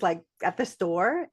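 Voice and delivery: ordinary delivery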